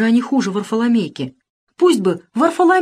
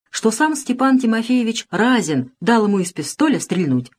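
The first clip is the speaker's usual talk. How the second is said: ordinary delivery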